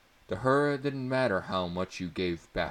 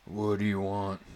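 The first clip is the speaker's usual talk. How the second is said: disgruntled man; 3 semitones higher, 4 dB louder than usual. A narrator's reading. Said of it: "a disgruntled man's voice asked".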